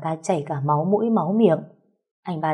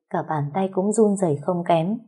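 usual speaking voice

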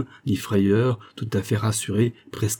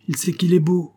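growled retort; 9 semitones higher, 3 dB louder than usual. A narrator's reading. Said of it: "gronda Patricia".

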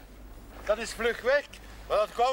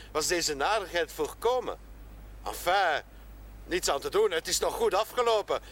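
normal talking voice

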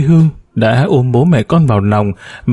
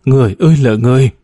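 quick anguished cry, a touch raised; faster than usual. A narrator's reading. Nghĩa cries out at the narrator, quick and anguished. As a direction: blurt it out in distress. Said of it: "cô Nghĩa hét lên".

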